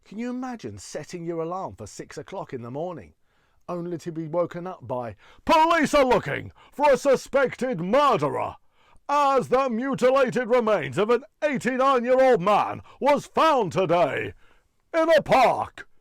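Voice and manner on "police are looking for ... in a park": bellowed, high-pitched mock news report; huge booming voice, stretching the last word; X Factor announcer as newsreader. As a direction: blast the grim news like a talent-show intro. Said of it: "he started out as a newsreader".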